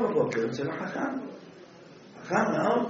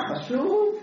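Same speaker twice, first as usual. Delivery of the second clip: incredulous squeal; slower than usual. Acted. Wife mocks husband's important business.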